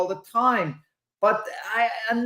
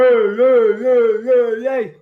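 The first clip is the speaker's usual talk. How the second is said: loud, higher mock crowd yell; imitating hyper fans; 5 semitones higher, 9 dB louder than usual. Guest mocking hyper fans at the bar, loud imitated yells. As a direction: mimic rowdy fans hollering at the camera. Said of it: "They're going".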